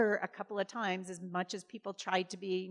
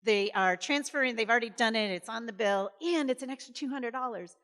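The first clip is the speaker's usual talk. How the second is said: louder, higher, bursting with good news; excited; 4 semitones higher, 7 dB louder than usual. Retold or imitated. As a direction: blurt out the great news. Delivering good news to mom.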